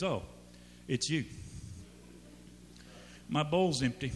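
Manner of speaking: lightly voiced indifferent cat, with long pauses; a hint of an underwhelmed cat; unimpressed cat. Cat on the counter greets owner, mildly unimpressed. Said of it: "your cat is sitting up on the counter".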